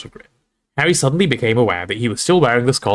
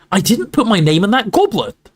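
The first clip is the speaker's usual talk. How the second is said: higher, firmer, more heated denial; getting angry; 10 semitones higher, 3 dB louder than usual. Acted. Harry repeating his denial to a disbelieving Ron, getting angry. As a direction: insist again, temper starting to rise. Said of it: "starting to feel angry".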